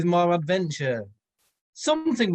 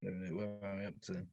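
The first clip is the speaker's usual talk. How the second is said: fast, soft mumble to yourself; muttered to self; 10 semitones lower, 18 dB quieter, much faster than usual. Real, not acted. Reader who lost his place, muttering quickly. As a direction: hurry under your breath to find the place.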